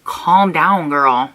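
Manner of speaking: low, slowed "calm down" to herself; self-scolding. Retold or imitated. Vlogger reacting to her own footage, low-pitched reining-in. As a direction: talk yourself down, pitched low. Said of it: "you're like".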